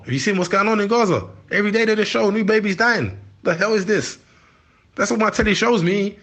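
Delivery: high, incredulous outrage; outraged. Real, not acted. Telling the interviewer about Gaza coverage, high outrage. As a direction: hold your disbelief at the news up high.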